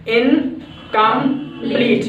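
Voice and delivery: Incomplete slowly split as "इन - कम - pleat"; broken into syllables. Shown as a demonstration. Teacher showing three syllables in incomplete, broken into syllables. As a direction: say incomplete in three drawn-out beats with pauses. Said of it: "there are also three syllables".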